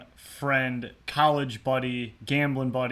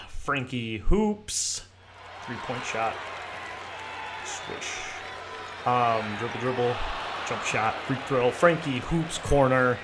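sports announcer; slower than usual. Acted. Mock basketball announcer segment.

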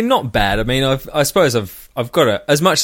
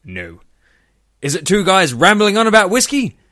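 superhero intro parody; 4 semitones higher than usual. Pretend.

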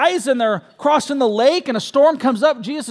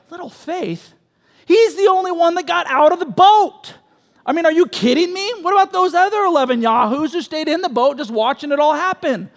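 higher and louder, disbelieving; incredulous outburst; 6 semitones higher, 3 dB louder than usual. Retold or imitated. Preacher voicing the crowd's disbelief, incredulous and louder. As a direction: protest like you can't believe your ears. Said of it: "And you're like".

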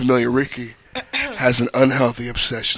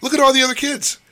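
put-on scolding a child; 7 semitones higher than usual. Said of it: "I'm like".

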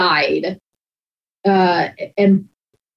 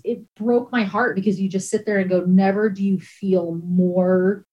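usual speaking voice

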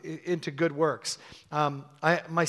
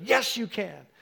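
strong, raised-pitch push; stirring exhortation; 6 semitones higher, slower than usual. Retold or imitated. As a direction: rouse them with real conviction. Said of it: "I was trying to stir it".

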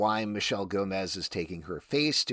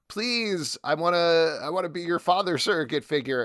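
pleading Cliff; 8 semitones higher, 4 dB louder than usual. Retold or imitated. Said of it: "Cliff being like".

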